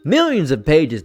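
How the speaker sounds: normal talking voice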